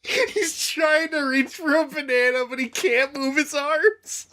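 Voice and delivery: laughing. Real, not acted. Laughing while describing monkey.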